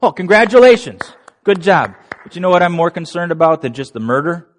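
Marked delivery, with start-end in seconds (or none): 0.0-1.9 high, loud, sarcastic praise; big mock cheer; mock congratulations. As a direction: congratulate them with exaggerated fake admiration. Mock praise voiced in sermon.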